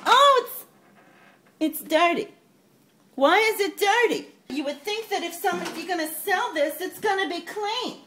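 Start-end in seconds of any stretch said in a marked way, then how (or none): 0.1-4.3 loud, high cry of disgust; outraged at the dirt; loud complaint about the dirty microwave. Acted.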